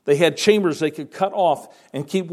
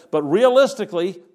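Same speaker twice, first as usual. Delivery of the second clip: ordinary talk, nothing marked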